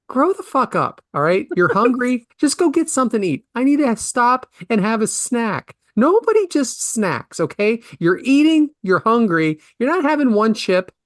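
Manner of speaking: high-pitched, indignant tirade; exasperated rant. Acted. Host scolding people who say snack, exasperated.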